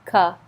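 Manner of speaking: Ka said loud and short, a u sound as in come; isolated syllable. Shown as a demonstration. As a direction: bark the first sound of Cover. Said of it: "This O sounds like a U".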